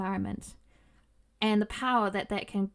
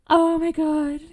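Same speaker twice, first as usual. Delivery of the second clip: gasp, then high, loud awe; excited anime fan; 6 semitones higher, 6 dB louder, slower than usual. Real, not acted. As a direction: starstruck gasp of delight. Dev reposted and liked.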